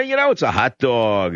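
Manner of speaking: ordinary talk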